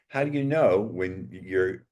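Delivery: normal talking voice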